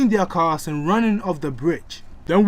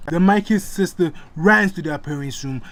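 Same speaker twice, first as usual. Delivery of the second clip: ordinary delivery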